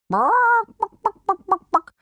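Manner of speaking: high, loud hen clucks; chickens clucking. A narrator's reading. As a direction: cluck back like eager hens. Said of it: "antworteten die Hühner".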